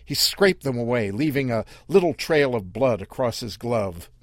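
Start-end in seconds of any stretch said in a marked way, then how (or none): none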